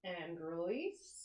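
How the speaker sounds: slow, soft and low; soft release cue. Shown as a demonstration. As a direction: let the word release them. End of the hold.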